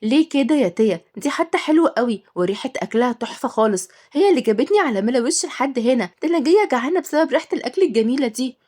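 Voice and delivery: plain reading voice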